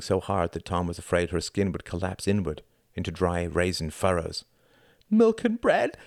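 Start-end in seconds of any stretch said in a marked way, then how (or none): 5.1-6.0 high, tearful character voice; sobbed plea. A narrator's reading. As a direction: ask for it through sobs, voice pitched way up. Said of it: "she sobbed".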